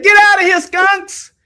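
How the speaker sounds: high, loud shooing yell; Auntie yelling. Retold or imitated. Auntie shouting a smoker out of her house, high and loud. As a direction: chase the smoker out at the top of your voice. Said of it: "she said".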